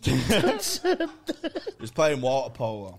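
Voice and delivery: slow words through laughter; drawn-out giggly delivery; laughing. Real, not acted. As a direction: laugh it out slowly. Laughing retelling of the plot.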